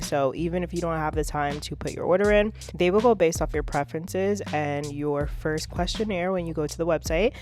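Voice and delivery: upbeat ad read over music; a light promo voice; sponsored ad read. Acted. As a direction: sell the meal service like a host.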